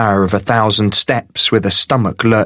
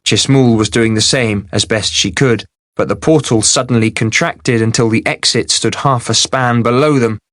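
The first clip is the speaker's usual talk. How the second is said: ordinary delivery, nothing marked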